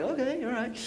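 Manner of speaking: high, soft little okay; awkward acceptance. Retold or imitated. Pastor hearing strangers curse at golf, awkward soft acceptance. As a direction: let it pass uneasily, in a small high voice.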